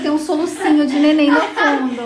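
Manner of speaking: giggling through the words; trying not to laugh. Real, not acted. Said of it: "Nós tamo tentando não rir, tá difícil".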